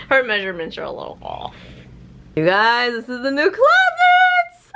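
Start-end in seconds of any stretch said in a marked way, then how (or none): 2.4-4.7 sung-out high reveal, slowed; excited reveal. Real, not acted. Mom showing viewers the closet, singing excitedly. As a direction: belt out the big closet reveal.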